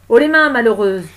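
forceful command to surrender; police challenge. Acted. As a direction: shout it as an armed warning. Armed police challenge her.

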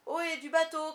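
high, loud captain's call; shouted hail. A narrator's reading. Captain hailing his boat, shouted hail. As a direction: holler it across the water. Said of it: "cria de nouveau la voix".

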